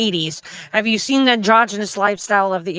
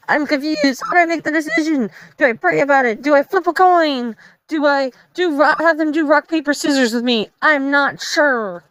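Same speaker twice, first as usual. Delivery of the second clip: higher, rising mock panic over a choice; panicking; 7 semitones higher than usual. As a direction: spiral into flustered dithering. Meme character panicking over choice.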